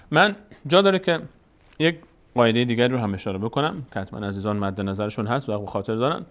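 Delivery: normal talking voice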